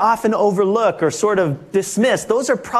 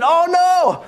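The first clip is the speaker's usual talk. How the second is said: shocked; 8 semitones higher than usual. Retold or imitated. Man realizes his Rolex is gone.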